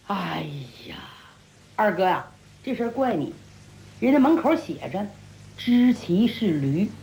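low, measured reproach after a sigh; reproving younger brother. A narrator's reading. Younger brother tells the brawler he is at fault, low reproof.

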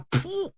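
sharp high puff of breath; short breathy blow; magic puff. Acted. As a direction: blow out a quick puff like casting a spell. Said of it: "拿嘴一吹".

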